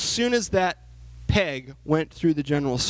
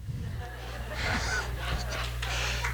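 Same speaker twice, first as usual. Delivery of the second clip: soft breathy laugh; 8 dB quieter than usual. Real, not acted. Laugh after he died.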